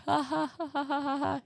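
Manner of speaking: high, spoken ha-ha-ha; mock laughter. Passenger reacting in the quiet car, fake high laughing. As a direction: fake an awkward string of laughs. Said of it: "you're like".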